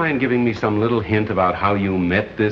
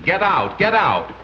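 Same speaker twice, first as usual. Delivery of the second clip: mock parrot scream; 7 semitones higher, slower than usual. A narrator's reading. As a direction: imitate a screaming parrot on air. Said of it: "the parrot was still screaming".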